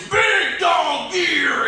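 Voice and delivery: high, loud, stretched-out bellow; shouted slogan. Acted. Big dog T-shirt yelling at everyone, shouted slogan. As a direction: holler it like a brash T-shirt. Voicing a graphic tee slogan.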